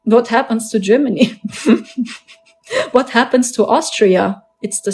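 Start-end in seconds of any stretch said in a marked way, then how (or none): 1.5-2.1 short laugh. Real, not acted. Laughs about leaving Germany.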